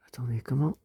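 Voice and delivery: whispered puzzled question; soft whispered aside. Real, not acted. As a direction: whisper it, confused, to yourself.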